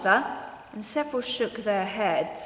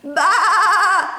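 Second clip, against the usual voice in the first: high, loud bleating baa; sheep bleat; 9 semitones higher, 9 dB louder than usual. Acted. Frightened sheep bleating at the boy. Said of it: "they bleated".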